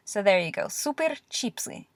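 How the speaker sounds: ordinary delivery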